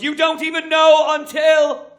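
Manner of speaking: mother insisting the jacket fits; indignant mother. Retold or imitated. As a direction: insist slowly and loudly. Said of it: "She goes".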